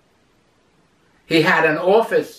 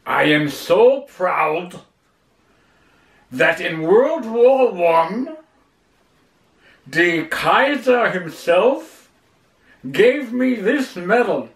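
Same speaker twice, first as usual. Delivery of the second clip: slow, higher, swelling with pride; proud old veteran; 6 semitones higher, 3 dB louder, slower than usual. Retold or imitated. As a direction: show off the medal to the dwarf, slowly. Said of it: "And he says".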